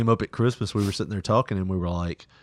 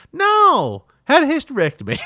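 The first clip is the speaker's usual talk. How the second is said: mom's voice; 14 semitones higher, 5 dB louder than usual. Retold or imitated. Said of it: "She's like".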